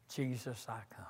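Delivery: hushed whisper; whispered refrain. Acted. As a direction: breathe the refrain out softly.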